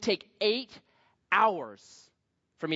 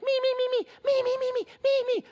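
very high mocking squeal, rapid repeats; eager volunteers; 4 dB louder than usual. Classmates begging to give their speech, squeaky mock eagerness. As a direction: mimic an overkeen pupil begging to be picked. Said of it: "you had these people like".

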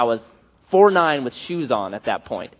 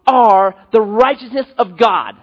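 loud, slow and higher, pressing every word; emphatic declaration; 6 semitones higher, 6 dB louder, slower than usual. Retold or imitated. Evangelist tells a new convert who he is, loud and slow. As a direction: proclaim it straight into his eyes. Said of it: "he looks at this guy and he says".

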